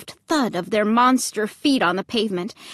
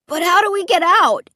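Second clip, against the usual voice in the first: high, loud, shrill question; shrill demand; 9 semitones higher, 5 dB louder than usual. A narrator's reading. Trapped boy demands answer from dad, shrill. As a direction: squeal it out in alarm. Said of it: "Luke demanded shrilly".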